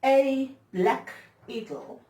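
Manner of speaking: slow, high, loud declaimed poem line; ringing, measured poetry performance; poem recitation. Acted. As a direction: declaim it slowly and loudly, like a stage poet.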